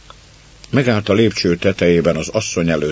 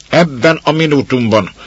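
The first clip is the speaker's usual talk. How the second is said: higher, louder snap; sharp rebuke; 8 semitones higher, 6 dB louder than usual. Acted. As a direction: snap the order at the servant. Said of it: "ripakodott rá a báróné".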